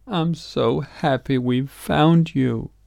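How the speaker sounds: a mother half crying with relief; happy tears. A narrator's reading. Mom holding Little Frog, mildly teary joy. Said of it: "she was crying".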